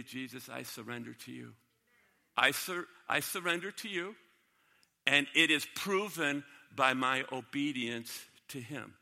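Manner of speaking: whisper-soft earnest prayer; quiet surrender prayer. Acted. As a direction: pray it low and surrendered. Praying aloud with raised hands.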